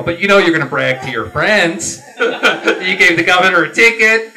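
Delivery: laughing retelling. Retold or imitated. Telling the crowd about the trooper, laughing. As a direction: crack up while telling the joke.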